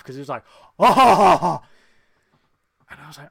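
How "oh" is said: big, booming mock laugh; imitated laugh. Host imitates Spock's laugh for co-host, booming mock laugh. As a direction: burst out with a loud, higher put-on laugh. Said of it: "it took me back to the laugh".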